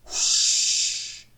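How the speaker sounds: loud rushing hiss of steam; steam whoosh. A narrator's reading. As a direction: imitate a burst of engine steam. Engine noise blocks tired Duck.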